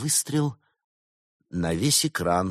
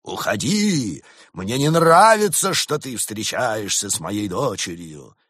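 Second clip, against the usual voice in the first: angry father; 3 semitones higher, 6 dB louder than usual. A narrator's reading. Angry father drives suitor away.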